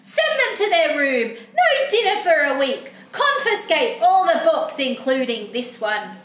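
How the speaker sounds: indignant tattling, calling out for the parents; cranky book character. Acted. Book character reporting the reader to their parents, cranky. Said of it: "Look how cranky he is".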